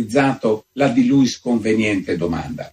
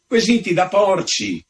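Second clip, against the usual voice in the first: a touch of outrage, pitched up; indignant outcry; 8 semitones higher than usual. A narrator's reading. Visitor throws her own words at the countess, mock outraged. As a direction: fling the words back at her, mildly. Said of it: "strepitò il visitatore".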